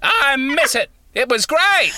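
high, shouted, laughing crude confession; comic acting out a crude onstage opener; mock stand-up bit. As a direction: blurt the joke out, cracking up. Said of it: "I might do that next time".

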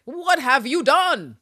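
high, loud, drawn-out demand; slow, hammed-up reproach; appalled. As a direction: act out theatrical horror, word by word. Voicing Peel's disapproval of an outfit.

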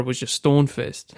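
ordinary delivery

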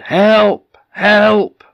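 high, loud shout for help; shouted cry for help. Acted. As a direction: shout out to anyone who might hear. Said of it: "So he shouted".

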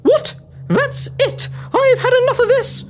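high-pitched indignation; exasperated outburst. Acted. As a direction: burst out at the end of your patience. Cornelia loses patience with Walton.

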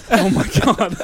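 cracking up over a blunder; laughing at oneself. Real, not acted. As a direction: exclaim through laughter. Laughing at own misreading.

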